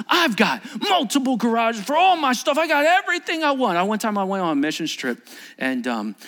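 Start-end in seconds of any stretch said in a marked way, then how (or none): 0.1-3.8 high, loud protest; acted indignant rant. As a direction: rail in frustration, pitched right up. Voices rich man's indignant rant.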